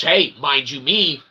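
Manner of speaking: ordinary talk, nothing marked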